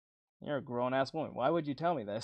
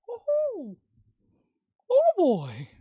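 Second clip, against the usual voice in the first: very high, loud cartoon mouse squeal; Mickey Mouse; 11 dB louder, slower than usual. Vlogger mocking his old kid voice, very high cartoon mouse squeal. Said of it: "I sound like fucking Mickey Mouse".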